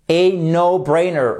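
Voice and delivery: slow, careful no-brainer; teacher modeling the expression; slow model word. Shown as a demonstration. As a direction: spell out no-brainer for learners. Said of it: "Faites bien attention".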